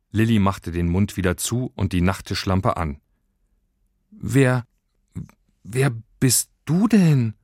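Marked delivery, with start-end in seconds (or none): 4.1-7.3 halting, higher startled question; stammering. A narrator's reading. Startled girl questions a strange creature, stammering. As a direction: stumble on the first word, then slowly ask. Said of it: "stammelte sie".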